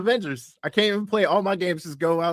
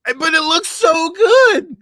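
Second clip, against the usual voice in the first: excited; 13 semitones higher, 8 dB louder, slower than usual. Real, not acted. Gushing over the trailer.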